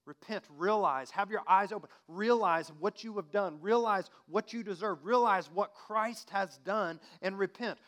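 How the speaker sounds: loud, high exhortation; fiery preacher's call; impassioned call to repent. Acted. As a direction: press the crowd to repent. Preaching climax.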